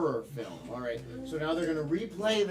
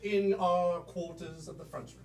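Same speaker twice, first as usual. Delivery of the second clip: much higher posh British lady voice; mock British accent; 4 semitones higher than usual. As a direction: play the mother-in-law in a hammed-up British accent. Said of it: "British, French, and German".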